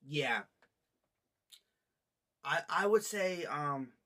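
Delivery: talking between sips; words muffled by cup; speaking with cup at lips. Real, not acted. Seen 0: Cup held at or against lips, drinking between words, lowered by 5.2.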